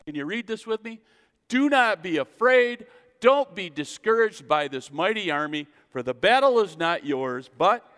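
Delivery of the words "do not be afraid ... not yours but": a preacher crying out the promise; preaching at full force. Acted. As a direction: proclaim it to the whole church. Preacher reads scripture at full force.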